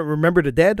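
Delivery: ordinary talk, nothing marked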